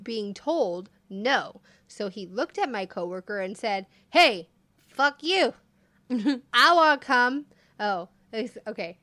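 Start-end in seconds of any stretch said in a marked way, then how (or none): 4.1-7.4 put-on loud slurred drunk challenge. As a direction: slur it out like a belligerent drunk. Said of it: "So I'm saying this in a drunk voice".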